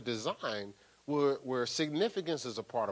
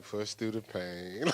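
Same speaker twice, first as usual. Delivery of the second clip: imitating Mike's knowing tone; 4 semitones lower, 6 dB quieter, slower than usual. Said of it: "he was like".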